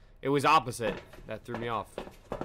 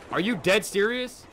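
incredulous; 7 semitones higher, 4 dB louder than usual. Real, not acted. Disbelief at the answer.

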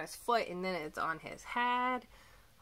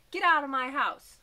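much louder, higher furious order; angry shout; 6 semitones higher, 10 dB louder than usual. A narrator's reading. Mom angrily orders the moose out of the house, loud. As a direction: throw it out with force.

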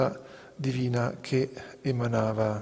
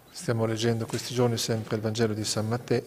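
usual speaking voice

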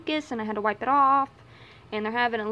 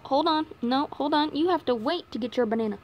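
ordinary delivery

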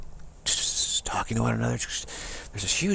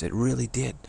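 ordinary talk, nothing marked

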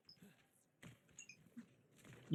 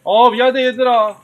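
loud, high sorry to the team; loud apology; 4 semitones higher, 8 dB louder than usual. Real, not acted. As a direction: call out the apology. Apologizes for wasted ultimate.

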